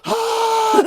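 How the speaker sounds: high, loud mock scream of fright; hammed-up scream on takeoff; retold scream. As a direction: yelp it like the ground just dropped away.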